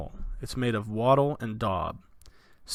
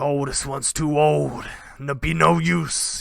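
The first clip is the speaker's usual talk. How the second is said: bandit king; 4 semitones higher, 4 dB louder than usual. Acted. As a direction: look the boy over and reject him. Bandit king judges boy.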